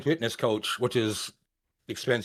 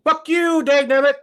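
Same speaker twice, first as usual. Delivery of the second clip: high-pitched loud squawk, hammed up; mock his thank-you with a shrill yelp; 11 semitones higher, 4 dB louder than usual. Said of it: "this is how he says thankful".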